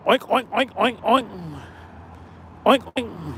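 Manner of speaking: soft mocking oink oink; mock pig oinks. Mocking Reg as a wild pig, soft oinks. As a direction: oink like a snitching pig, quietly. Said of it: "He did the wild pig".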